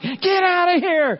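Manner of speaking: high, loud, slow mock yell. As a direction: yell it out with hammed-up exasperation. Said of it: "I'm like".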